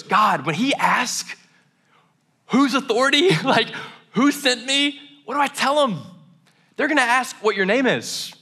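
high, worried pleading voice as Moses; anxious Moses. Retold or imitated. Moses anxiously pleading with God before facing Pharaoh, high worried voice. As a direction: fret like a man sure Pharaoh will laugh him off.

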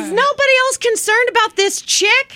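high, disbelieving panic; mock panic; alarmed. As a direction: raise it up in alarm. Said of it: "I'm like".

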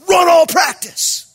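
high, slow yelled order; screaming coach. Retold or imitated. Head coach sending the late kid running, imitated scream. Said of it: "He's screaming at this kid".

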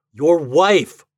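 loud, high insistence; protest. A narrator's reading. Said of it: "protested Ford".

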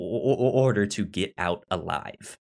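normal talking voice

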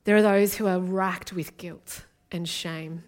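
usual speaking voice

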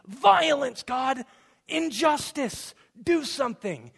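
high, loud anguished cry to God; desperate plea. Retold or imitated. Habakkuk pleading with God about violence, loud anguished cry. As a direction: cry out demanding that God act. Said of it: "So his problem is".

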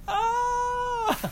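very high, sustained wail; re-enacted audience shock as a long "ah"; imitated cry. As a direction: let out a high startled cry. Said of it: "I felt it in my body".

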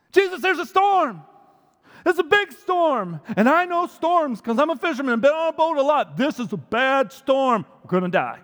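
high, loud, worried pleading; hammed-up fisherman in a panic; panicked Peter. Peter warning Jesus about the storm, mock panic. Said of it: "Peter warns Jesus".

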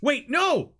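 high, loud cry of wait; desperate shout. Acted. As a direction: yell out in panic. Desperate call.

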